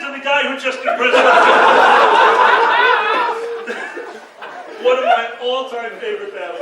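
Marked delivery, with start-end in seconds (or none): 1.3-4.7 long, high, loud laughter; real roaring laugh. Real, not acted. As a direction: burst out in helpless group laughter. Group laughs at story.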